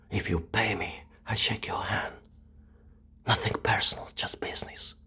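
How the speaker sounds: hushed mafia whisper. Acted. Offering a paid killing, in a cold whisper. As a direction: whisper it like a hitman's deal.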